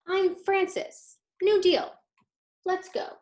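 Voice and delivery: put-on high, bright kid's voice, loud on New Deal; a proud child announcing herself; eager little girl. Girl character voiced in story.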